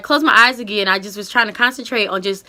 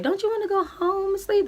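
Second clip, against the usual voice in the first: high, soft mock nurse voice; 11 semitones higher, 6 dB quieter than usual. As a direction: play the hurried nurse sweetly.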